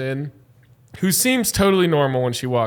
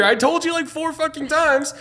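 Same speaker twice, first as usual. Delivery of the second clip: pitched way up, fed up and scolding; re-living telling her off, voice high and strained; exasperated yell; 11 semitones higher than usual. Retold or imitated. Bartender scolding a drunk customer in the dark basement, high exasperated yell.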